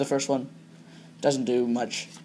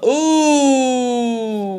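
high, delighted ooh at the pull; excited ooh; 8 semitones higher, 5 dB louder than usual. Real, not acted. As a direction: gasp of real delight.

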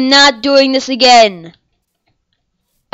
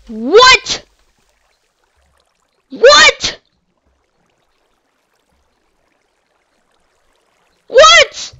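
shocked disbelief; 11 semitones higher, 3 dB louder, much slower than usual. Real, not acted. Just died in hardcore mode.

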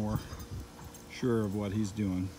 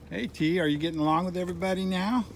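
cooing to a dog; 6 semitones higher, faster than usual. Real, not acted. Owner greeting the shy new rescue dog in sing-song baby talk. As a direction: coo at him like a pet owner.

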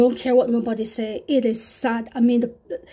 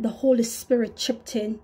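normal talking voice

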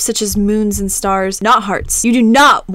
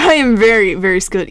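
normal talking voice

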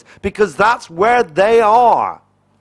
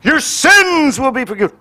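mock preacher shout; 4 semitones higher than usual. Mocking judgmental preachers.

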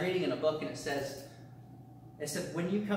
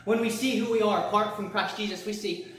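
raised, louder preaching voice; 5 semitones higher, 5 dB louder than usual. Acted. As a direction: preach it out to the church. Preaching with force.

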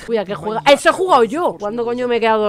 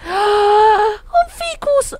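gasped surprise; 9 semitones higher, much slower than usual. Real, not acted. Gasps at a plant.

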